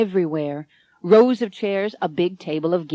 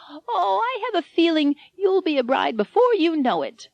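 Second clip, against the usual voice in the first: put-on high, frail old lady, beaming; a happy old bride teasing her nurse; high old woman's voice, delighted; 11 semitones higher than usual. A narrator's reading.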